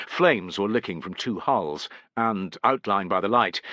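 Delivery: plain reading voice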